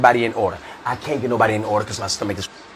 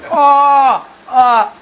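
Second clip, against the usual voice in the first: high, loud howls of mirth; hammed-up hysterics, words stretched into wails; acted dying laughing; 12 semitones higher, 6 dB louder, much slower than usual. Buddy falls out laughing at his friend's ailment, howling hysterically. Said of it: "Dying laughing".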